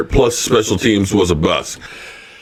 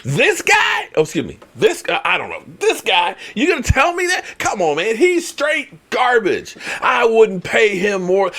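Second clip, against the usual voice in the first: high, scoffing disbelief, climbing into a yell; pundit hamming up disgust; mock outrage rant; 9 semitones higher than usual. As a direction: act appalled at the quarterback. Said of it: "I'd be doing stuff like this".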